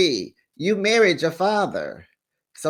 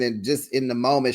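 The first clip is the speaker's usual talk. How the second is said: ordinary talk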